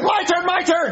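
yelling. Acted. Kid demanding his turn, high shout.

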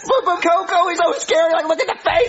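scared whining. Acted. Scared of Coco.

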